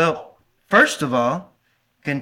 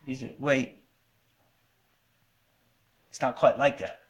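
much softer, lower hesitant objection; quiet aside; 7 semitones lower, 11 dB quieter than usual. Retold or imitated. Preacher voicing a listener's hesitant objection, quiet. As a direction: murmur it almost to yourself.

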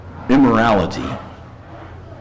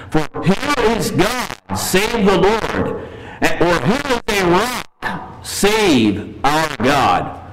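slow, higher reverent reading; scripture reading; 6 semitones higher, much slower than usual. Acted. Preacher reading the psalm's question to the church, slow and reverent. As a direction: draw the question out slowly, reverently. Reads verse thirty-one.

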